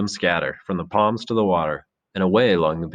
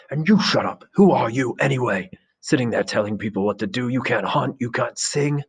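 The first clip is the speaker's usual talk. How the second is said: angry retort; 5 semitones higher than usual. Acted. Jack mocking Ralph before the boys, high angry scorn. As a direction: tear into him with scorn.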